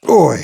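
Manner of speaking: loud grunt of strain; effort grunt. Real, not acted. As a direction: grunt as the weight comes up. Straining under the engine's weight.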